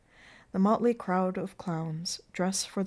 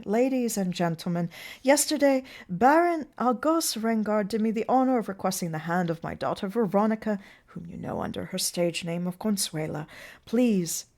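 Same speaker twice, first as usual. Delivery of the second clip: theatrical toast to a gathered crowd, raised and projected; proud father's announcement; 5 dB louder than usual. Acted. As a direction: address the guests grandly, with emphatic openings. Plays Mancini announcing the engagement.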